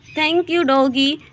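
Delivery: high, slow, cooing "Thank you doggy"; sweet thank-you. Acted. Neighbour thanks the dog, high slow cooing. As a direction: coo it to the dog like a baby.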